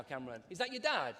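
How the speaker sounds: usual speaking voice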